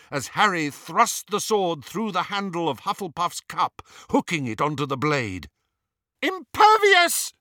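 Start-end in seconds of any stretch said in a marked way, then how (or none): 6.2-7.3 very high, loud, drawn-out screech. A narrator's reading. Hermione protecting her friends from burning metal, screeching. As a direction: screech the spell at the top of the voice. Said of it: "screeched Hermione".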